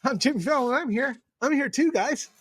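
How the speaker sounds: high-pitched attention-seeking mock voice; Jimmy Fallon. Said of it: "she would do like Jimmy Fallon".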